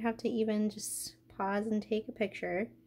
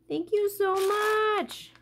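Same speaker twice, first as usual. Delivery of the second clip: much higher, slow gushing thank-you; 9 semitones higher, 3 dB louder, slower than usual. Real, not acted. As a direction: pour out the gratitude. Thanking friend for gift.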